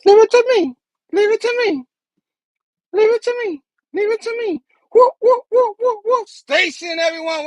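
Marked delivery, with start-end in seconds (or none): 0.1-4.6 high-pitched catchphrase copy; imitating catchphrase. Echoing the catchphrase clip. 4.9-6.3 whoop whoop. Acted. Siren-like cheering.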